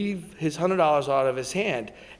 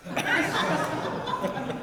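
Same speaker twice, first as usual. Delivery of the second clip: airy high laughter; unvoiced giggling laugh; real laugh; 12 semitones higher, 9 dB quieter than usual. Real, not acted. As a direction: laugh at the banana bargaining joke. Laughing at own joke.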